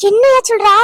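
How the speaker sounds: can't believe the good news; stunned surprise. Acted. Aunt hears daughter's suitor comes. (வியப்புடன் உரத்த குரல்)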